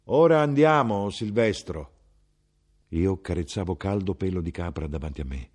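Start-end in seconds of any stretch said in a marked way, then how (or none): 0.1-1.9 higher, louder call across the dark room. Acted. Mother calling Silvestro from the dark to leave, loud call. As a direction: call the son over to leave. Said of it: "gridò a me".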